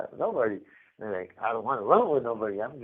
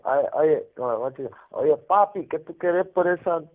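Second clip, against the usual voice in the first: mock Puerto Rican Spanish, louder and higher; loud, higher Puerto Rican street Spanish; Puerto Rican accent; 5 semitones higher, 6 dB louder than usual. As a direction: imitate a fresh-off-the-island Puerto Rican asking for the chain. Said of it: "you know how they talk".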